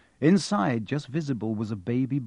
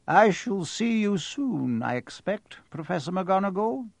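Dumbledore voice; 6 semitones higher than usual. Acted.

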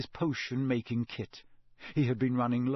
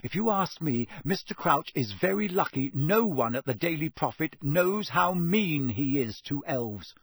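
ordinary delivery, nothing marked

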